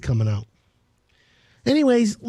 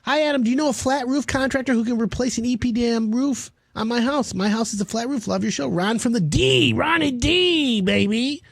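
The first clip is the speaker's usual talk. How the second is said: caller voice; 7 semitones higher than usual. Retold or imitated. Reads a caller's text in voice.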